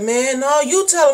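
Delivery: put-on high, pushy kid voice; little sister's refusal. Little sister refusing to tell dad, high and pushy. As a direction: push the job back onto your sibling. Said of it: "And she's like".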